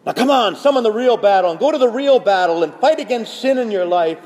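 loud, high, driving exhortation; rallying battle cry. Acted. Priest summoning people to fight sin, a rousing shout. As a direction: summon them to the fight at full voice.

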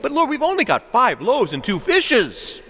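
high, exclaimed objection; protesting disciple. Acted. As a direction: plead that there is too little.

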